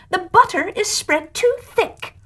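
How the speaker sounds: high, complaining voice; fussy carpenter. Acted. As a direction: grumble about the butter. Carpenter complains about the bread.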